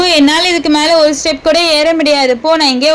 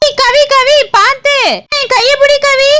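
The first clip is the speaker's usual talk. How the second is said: urgent call; 7 semitones higher than usual. Acted. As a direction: shout her name to warn her. Calling to falling friend.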